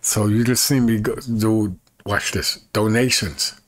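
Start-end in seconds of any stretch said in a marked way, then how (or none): none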